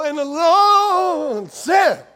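loud, high wail drawn out long on "Lord"; whining preacher. Acted. Pastor adds a little whine to his preacher imitation, wailed long and loud. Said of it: "throw in a little wine".

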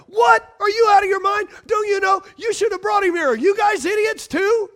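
high, loud furious shouting; outraged Pharisees. Retold or imitated. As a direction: blow up at the soldiers in disbelief. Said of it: "[name] Amplified version".

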